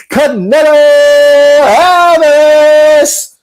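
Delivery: high, drawn-out ring announcer call. Pretend. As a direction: stretch the name out like a fight introduction. Hypes boxer's name like ring announcer.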